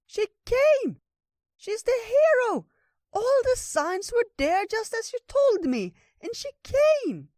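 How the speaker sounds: very high, loud squeal of a voice; excited little critter. A narrator's reading. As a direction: squeak out the big news, stretched and jubilant. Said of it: "jumped up and down excitedly".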